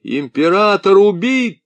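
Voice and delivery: loud, high blurted news. A narrator's reading. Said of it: "выпалил Бутри".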